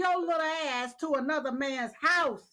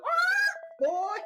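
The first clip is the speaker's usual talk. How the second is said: gleeful shout; 13 semitones higher than usual. Retold or imitated. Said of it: "I was".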